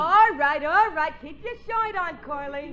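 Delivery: put-on loud, brash Brooklyn accent tossed off; New York accent. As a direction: snap it back like a New Yorker. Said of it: "Somehow from you it sounds natural".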